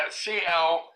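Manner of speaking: ordinary delivery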